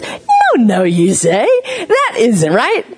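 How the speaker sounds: high, playful sing-song "no, no"; said differently, gently. Shown as a demonstration. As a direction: coo the correction to a baby. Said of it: "We say it like this".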